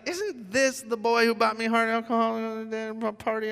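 high, singsong-level incredulous muttering to oneself; mock judging. Newcomer silently judging Jason in church, mock judging. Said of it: "I was totally judging him".

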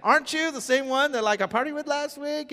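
high-pitched incredulous challenge; mock accusing. Old party friend doubting a new churchgoer, mock accusing.

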